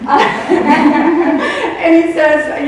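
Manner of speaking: laughing through the words, slowly. Real, not acted. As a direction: crack up while trying to go on. Laughing at funny dog video.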